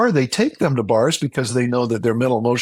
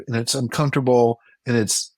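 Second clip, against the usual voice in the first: usual speaking voice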